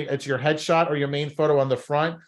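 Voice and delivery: ordinary talk, nothing marked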